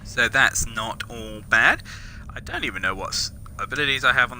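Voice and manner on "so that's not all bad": normal talking voice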